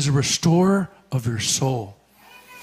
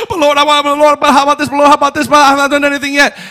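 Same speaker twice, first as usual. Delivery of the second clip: whining plea; 8 semitones higher, 5 dB louder, much faster than usual. Acted. Acted whining complaint to God.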